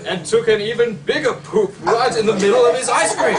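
giggling at the silly bit mid-sentence; laughing. Real, not acted. Reading the ice cream poop aloud, laughing.

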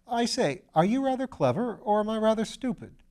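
lightly voiced nervous young chap; eager young man. A narrator's reading. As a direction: gush like a keen, naive student. Piggott asks earnestly.

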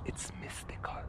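breathy mystical whisper. Acted. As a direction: whisper it like a secret. Whispered aside about the garden.

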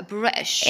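"بريتش" with the T swallowed; British glottal stop. Shown as a demonstration. Teacher showing British option two, glottal-stop pronunciation. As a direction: say "بريتش" as "Bri'ish". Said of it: "كأنها همزة على السطر".

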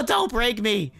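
ordinary talk, nothing marked

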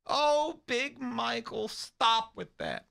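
high, slow doddering mom voice; mock mom scolding. Mom scolding her son, high and slow. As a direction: chide him like a dotty old mother.

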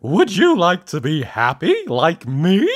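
high, loud, cheery cartoon voice; Mister Happy. A narrator's reading. Happy visitor offers happiness to his lookalike, high and cheery. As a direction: offer it with big happy gusto. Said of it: "Why are you so miserable".

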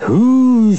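loud, high steam-engine whoosh; hissing whoosh. A narrator's reading. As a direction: blow a high whoosh that ends in a hiss. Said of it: "they hissed".

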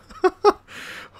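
breathless high giggle; a wheezy high chuckle; high airy laugh. Real, not acted. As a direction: laugh up in the head. Breathy laugh after chase.

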